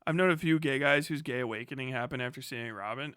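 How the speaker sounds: normal talking voice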